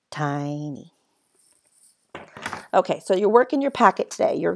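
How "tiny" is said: "Tiny" softly, with a stretched TI; long I sound. Shown as a demonstration. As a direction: gently draw out the I in "Tiny". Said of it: "It gets the long sound".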